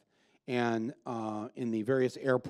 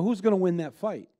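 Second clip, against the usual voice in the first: usual speaking voice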